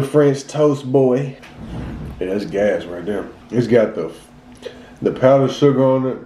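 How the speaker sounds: chewing while talking; talking between bites; mouth full. Real, not acted. Seen 1: Bites cookie at -2; jaw keeps chewing, lips pressed through speech.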